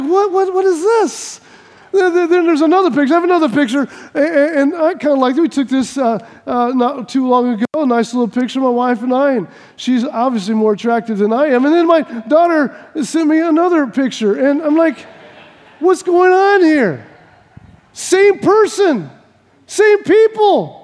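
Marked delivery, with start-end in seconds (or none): 0.0-1.4 high baffled what is this; mock bewilderment at the photo. Pastor baffled by daughter's photo, high mock disbelief. 15.8-20.8 high, slow incredulous what's going on; drawn-out amazed double take; mock disbelief. Pastor looking at wife's photo, slow high disbelief.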